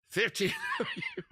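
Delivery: high laugh breaking through fifteen; amused burst of laughter. Real, not acted. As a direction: crack up mid-word. Host laughing at score.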